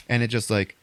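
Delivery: ordinary delivery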